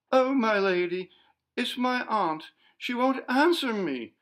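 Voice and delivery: high, loud, panicked pleading; frightened maid blurting out bad news; terrified stammer. A narrator's reading. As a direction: stammer it out in terror. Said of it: "stammered in a voice of terror".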